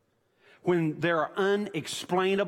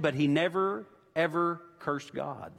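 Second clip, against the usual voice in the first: ordinary talk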